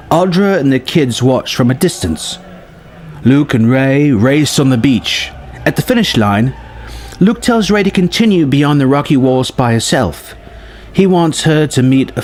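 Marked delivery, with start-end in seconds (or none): none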